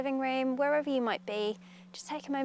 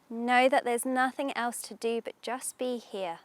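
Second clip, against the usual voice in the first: guided meditation; 3 dB louder than usual. Acted. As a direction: ease them into calm, unhurried.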